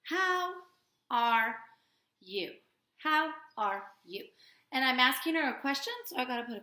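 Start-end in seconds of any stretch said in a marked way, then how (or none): 0.0-4.3 slow reading. Shown as a demonstration. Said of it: "I just gotta know 'em".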